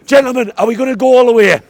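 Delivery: excited football fan rallying his friends; pub rallying cry. Real, not acted. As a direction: rev up the mates for the cup run.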